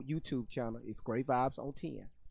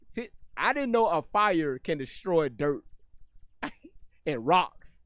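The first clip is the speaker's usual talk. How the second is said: laughing in disbelief; 3 semitones higher, 8 dB louder than usual. Real, not acted. Mocking a claim about fires.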